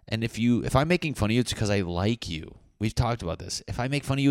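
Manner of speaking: ordinary talk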